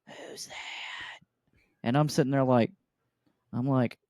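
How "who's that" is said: breathy whispered question. Retold or imitated. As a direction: whisper it over the screen. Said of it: "She's like".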